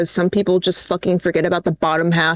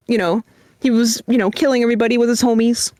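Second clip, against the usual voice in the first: usual speaking voice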